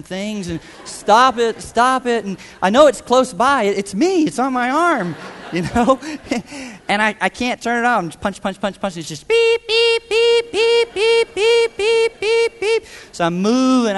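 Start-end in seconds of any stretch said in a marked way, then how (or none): 0.8-2.2 mock frantic stop. Retold panic at the alarm. 9.0-12.8 high piercing beep beep imitation; imitated alarm beeping.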